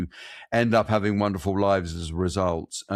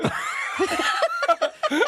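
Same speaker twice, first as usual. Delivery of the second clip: high burst of laughter. Real, not acted. Jack not in film.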